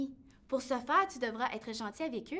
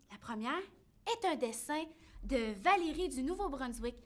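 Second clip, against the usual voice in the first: ordinary delivery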